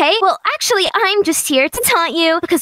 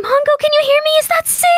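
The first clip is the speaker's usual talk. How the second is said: higher-pitched anxious questions; nervous; 7 semitones higher, faster than usual. Acted. Friend asking the prankster before entering the prison, nervous. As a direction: check in uneasily.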